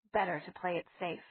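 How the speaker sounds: ordinary delivery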